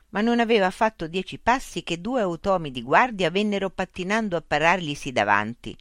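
plain reading voice

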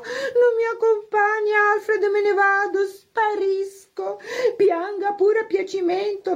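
very high, theatrical sympathy; affected, solicitous guest. A narrator's reading. Isabel takes leave of her poor friends, theatrically sorrowful. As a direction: draw out the farewell with showy pity.

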